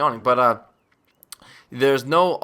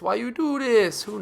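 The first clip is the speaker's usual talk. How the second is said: mock whine; 10 semitones higher than usual. Whining at noob tuber.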